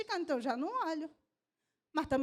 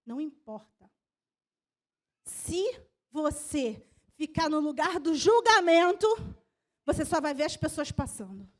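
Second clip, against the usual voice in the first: normal talking voice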